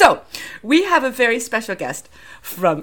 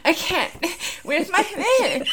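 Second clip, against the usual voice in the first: flustered; 9 semitones higher, 4 dB quieter, slower than usual. Retold or imitated. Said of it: "I was just like".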